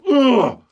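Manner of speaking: high, loud frustrated "ugh"; fed-up grunt at a stubborn client; exasperated groan. Real, not acted.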